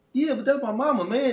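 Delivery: put-on whiny protest from the kid; son protesting. Imprisoned youth defending his mama, lightly voiced and high.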